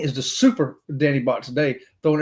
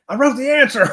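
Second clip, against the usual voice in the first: higher, louder mock protest; defensive self; 10 semitones higher, 6 dB louder than usual. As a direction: protest innocently, pitched up. His defensive reply to wife.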